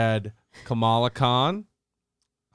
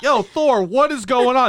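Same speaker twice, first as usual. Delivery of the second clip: higher, louder call to a teammate; mock call on comms; 10 semitones higher, 4 dB louder than usual. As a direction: yell down the headset at a wandering player. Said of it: "I imagine guys on the comms like".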